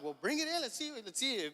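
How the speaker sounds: high, eager coaxing of a son reeling in; eager urging. Retold or imitated. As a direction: pitch it up, egging him on.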